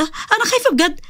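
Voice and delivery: very high, hushed terrified wail. Acted. Sara begging Jamr to stop scaring her, very high terrified whimper. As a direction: whimper it high in real fear. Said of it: "سارة فضلت تصوت".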